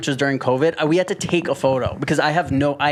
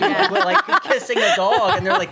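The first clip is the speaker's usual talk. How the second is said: high laughing voice while telling the story; giggling high as he tells it; 13 semitones higher than usual. Real, not acted. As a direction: laugh through the words, pitch way up.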